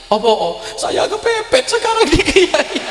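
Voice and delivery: high, slow mock-tearful plea; imitated desperate lament. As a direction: act out a cornered man's wail. Distressed layman confides in the kiai. (menirukan ratapan orang kepepet)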